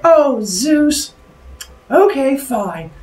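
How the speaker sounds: high-pitched, drawn-out damsel voice; swooning. Acted. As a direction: sigh it high, melting into consent. Leto giving in to Zeus.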